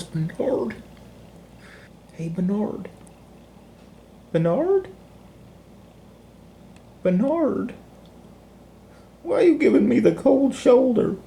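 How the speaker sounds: high coaxing pet voice; doggy talk. Acted. Owner coaxes his ignoring dog, high doggy talk.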